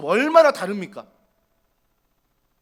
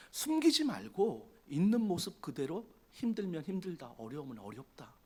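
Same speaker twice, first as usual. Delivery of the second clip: tender reassurance; 9 semitones lower, 17 dB quieter than usual. Acted. Voicing God's comforting words.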